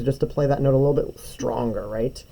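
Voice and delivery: ordinary talk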